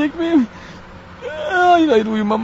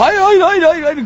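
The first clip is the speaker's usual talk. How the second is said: frantic refusal; 6 semitones higher, 4 dB louder, slower than usual. Acted. Refuses hospital in panic.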